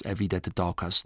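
usual speaking voice

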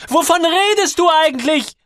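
very high, furious shout; loud, high, confrontational roar; bellowed question. A narrator's reading. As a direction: yell the demand at full pitch. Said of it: "brüllt sie wieder".

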